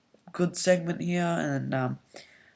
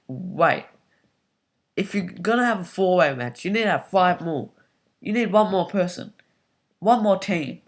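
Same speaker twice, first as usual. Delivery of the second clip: excited objection; 3 semitones higher, 5 dB louder than usual. Real, not acted. Fan objecting to match setup.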